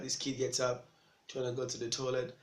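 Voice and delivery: ordinary talk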